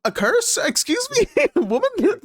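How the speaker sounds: high squeaky incredulous voice; shocked mock disbelief. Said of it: "that's why he's like".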